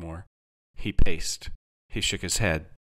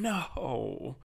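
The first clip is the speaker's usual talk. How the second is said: softer moan, sinking away; drawn-out, defeated groan; 5 semitones higher, 7 dB quieter than usual. A narrator's reading. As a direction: let the denial die away in a moan. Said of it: "he moaned again".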